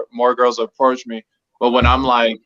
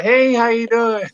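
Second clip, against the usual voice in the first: bright, high, fake-friendly server voice; chirpy fake-cheerful greeting; customer service voice; 11 semitones higher than usual. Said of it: "I be faking it with the table".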